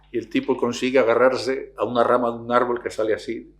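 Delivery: ordinary talk, nothing marked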